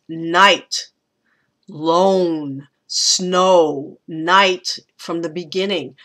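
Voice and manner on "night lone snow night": "Night", "lone", "snow", "night" each set apart and weighted; isolated, drawn-out stresses on "Night", "lone", "snow"; emphasized key words. Shown as a demonstration. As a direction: land heavily on every single word, with pauses between. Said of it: "So it gets emphasized".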